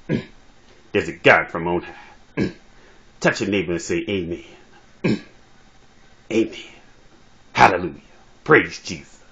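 hammed-up pastor winding up the sermon; comic preacher. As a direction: preach to the pews with pauses and hummed "mm". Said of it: "talk like this brother being a preacher".

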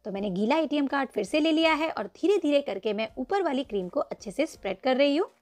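ordinary talk, nothing marked

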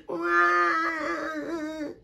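high, drawn-out acted baby wail; baby crying wail. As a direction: cry out like a baby piglet. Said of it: "Baby Pig began to cry".

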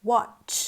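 watch with the ch pushed out, lips rounded forward; ch sound. Shown as a demonstration. Teacher demonstrates lip-rounded ch in watch. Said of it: "จังสี่นะคะ".

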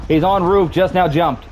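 urgent callout. Real, not acted.

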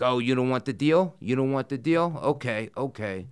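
high, loud, taunting prosecutor voice; mocking prosecutor. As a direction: taunt him like a smug prosecutor. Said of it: "what a piece of work she was".

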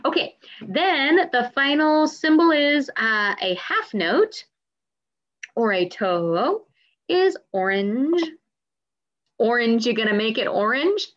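normal talking voice

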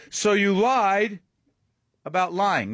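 mocking disbelief, slow; sarcastic jab. Real, not acted. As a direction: drag out "lied" like a gotcha. Host mocking Clinton's answer.

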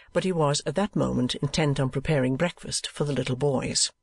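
usual speaking voice

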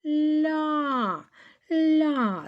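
"Ля" high and drawn out with softened л; long, careful "Ля"; soft л. Shown as a demonstration. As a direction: hold the soft л, then open into а in "Ля". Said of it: "м'яко лі і а".

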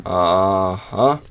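usual speaking voice

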